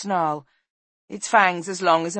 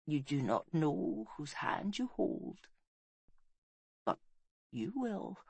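slow, hushed dying words; soft, fading last breath; whisper; 10 dB quieter than usual. A narrator's reading. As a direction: whisper it faintly, with pauses. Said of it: "she whispered".

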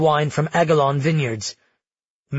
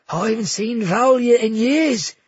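gushing innkeeper; 7 semitones higher, 3 dB louder than usual. Acted. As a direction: fawn over the princes in a high, thrilled voice. Bruno dodging questions.

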